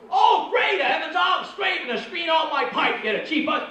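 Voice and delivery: high, loud outraged laughing rant; indignant friend. Retold or imitated. Stoned friend yells at comic over coke, loud outrage. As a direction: blow up at your buddy for holding out.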